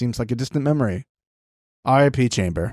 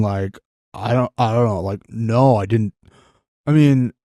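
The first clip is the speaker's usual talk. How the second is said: playing Josh. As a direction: stammer and dodge like a guilty Josh. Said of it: "I'll be Josh".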